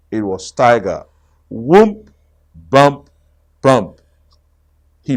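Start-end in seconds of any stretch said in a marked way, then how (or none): 1.5-4.0 bounce sound words. A narrator's reading.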